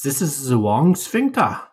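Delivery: half-hearted German voice, drawn out; bad German accent. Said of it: "that's my bad German accent".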